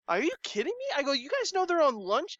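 high-pitched disbelief, voice jumping up; can't believe they're all at lunch; incredulous. Retold or imitated.

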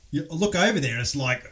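ordinary talk, nothing marked

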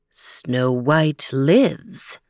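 low, slow otherworldly mirror voice; magic mirror voice. Acted. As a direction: intone it like an ancient spirit. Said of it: "said the magic mirror".

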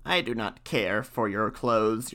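a lightly voiced croaky frog. A narrator's reading. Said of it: "The frog answered".

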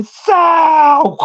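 high, drawn-out yell of a name; alarmed shout. Retold or imitated. Passenger to driver as a car comes at them, alarmed shout. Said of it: "look up to my right and say".

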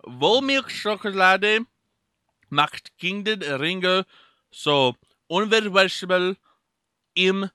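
loud, slow, deliberate German; put-on German reading. Podcaster reading a chocolate label to co-host, hammy German. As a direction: declaim it in a slow German accent.

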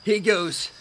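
plain reading voice

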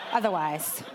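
slow, giggling mock retort; laughing. Wife laughing while mock-quoting her own excuse. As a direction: play it as a teasing comeback. Jokingly quotes herself, laughing.

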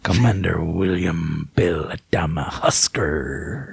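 very low, slow commander voice; deep, drawn-out announcement; Adama voice. Retold or imitated.

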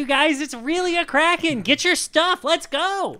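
high-pitched, pressing call; rally them to move at once; urgent rallying cry. Acted. Lookout rouses disbelieving crew, urgent high shouting.

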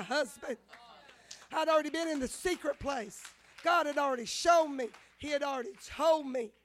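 loud, preaching insistence on what God told her; fervent declaration. Retold or imitated. To her friend, declaring God's promise in a loud preaching voice. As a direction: proclaim it like a promise you won't let go.

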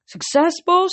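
high, loud horse voice asking; a cheeky horse checking in; pegasus voice. Acted. Blackjack the pegasus asking his boss, in a high horse voice.

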